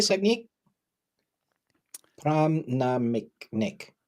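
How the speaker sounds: name said with an Indian accent. Shown as a demonstration. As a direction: pronounce it the Indian way. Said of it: "I'm trying to do an Indian accent".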